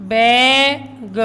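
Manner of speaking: बैग with held vowel, ग split off; sounded out. Shown as a demonstration. Teacher reading letters to pupils, बैग slowly sounded out. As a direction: stretch "bae" in बैग, then add "guh". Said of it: "ऐसे बीजी".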